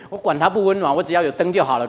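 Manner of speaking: usual speaking voice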